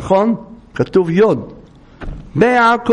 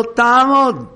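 raised-pitch order, lightly acted; retold command; 6 semitones higher, slower than usual. Rabbi stopping a bus for a funeral, retold command. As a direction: order the driver to halt, mock-stern.

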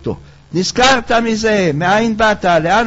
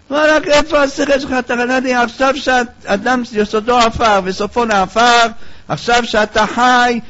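mocking hedonist; 5 semitones higher than usual. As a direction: scoff at him and call to party. Friend scoffs and urges feasting.

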